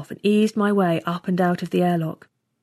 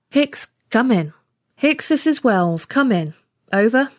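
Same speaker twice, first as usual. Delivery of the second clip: radio call; 4 semitones higher than usual. A narrator's reading. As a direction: call out over the radio for a crewmate. Radioing a missing crewmate.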